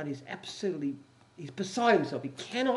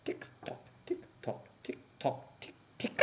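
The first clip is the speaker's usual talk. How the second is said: whispered ticking; 4 semitones lower, 8 dB quieter than usual. Acted. Prisoners ticking for commandant.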